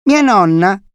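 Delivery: put-on high, loud child's voice; chatty little boy. A narrator's reading. Boy distracting a visitor with talk of grandmother, chatty child. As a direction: start telling it as an eager small boy. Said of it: "incominciò a raccontarle della nonna".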